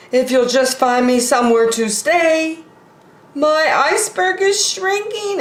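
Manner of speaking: high, pleading polar bear asking for a home. Acted. Polar bear begs Bear for somewhere to stay, high and pleading. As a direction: beg for a place to stay, voice pitched high.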